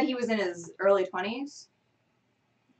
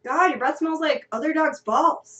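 Phil talking to dog; 7 semitones higher, 6 dB louder than usual. Acted. Phil voiced scolding his dog.

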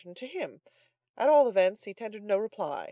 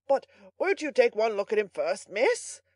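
raised, louder coaxing; proud eagerness; 5 semitones higher, 6 dB louder than usual. A narrator's reading. Butler urging miss to view his captive, proud pleading. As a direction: show off your prize with pride. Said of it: "with as much pride as if Oliver".

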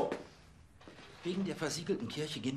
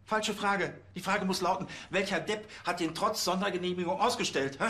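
louder, higher angry tirade; angry scolding; 4 semitones higher, 4 dB louder than usual. Acted. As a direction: tear into the officers. Boss storms in, scolding.

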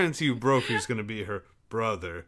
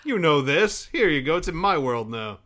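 brazen copycat author; 5 semitones higher, 6 dB louder than usual. Acted. Voicing the author defending copied names.

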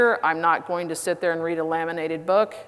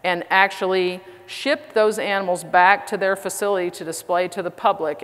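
ordinary delivery

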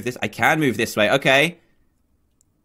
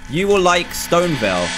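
normal talking voice

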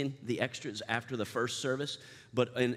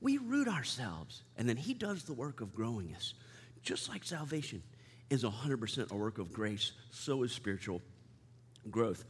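soft preaching; 3 semitones lower, 7 dB quieter than usual. Real, not acted. Sermon turns soft and slow.

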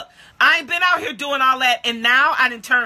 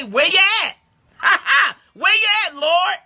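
mock cry to God; 8 semitones higher than usual. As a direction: cry out to the Lord half-joking. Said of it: "Y'all done said".

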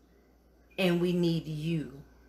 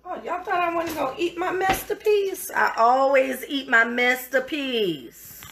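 higher and fast, teasing the audience; playful excitement; 6 semitones higher, 3 dB louder than usual. Real, not acted. Teasing viewers about eating her own dish, playfully excited. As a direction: tease them, then proudly louder.

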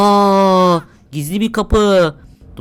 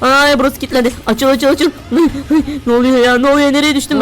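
panicked; 5 semitones higher than usual. Real, not acted. Player trapped behind a locked door after falling, panicked. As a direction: blurt it out pitched up, in a panic.

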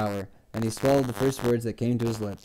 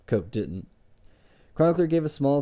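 usual speaking voice

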